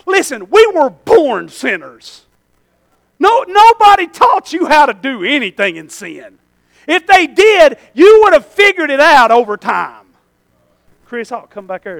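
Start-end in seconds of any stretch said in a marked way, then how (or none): 0.0-10.1 loud, high, driving sermon cadence; preaching at full force. Acted. Preacher hammering the congregation, loud and rising. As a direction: preach it hard to the pews.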